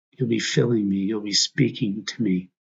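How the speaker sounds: normal talking voice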